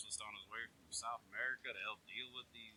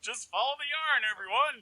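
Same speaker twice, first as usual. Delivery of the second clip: calling out; 13 semitones higher, 16 dB louder than usual. Acted. Calling out as a joke.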